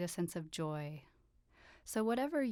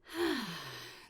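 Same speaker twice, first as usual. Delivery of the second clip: long audible sigh out; demonstrated exhale. Shown as a demonstration. Teacher showing listeners how to exhale all the way, long sigh. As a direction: let all the breath go in one falling sigh. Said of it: "exhaling all the way out".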